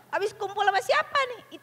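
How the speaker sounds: high mock interrogation; nagging. Parent grilling who they hung out with, nagging. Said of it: "Biasanya Anda kan begitu kan".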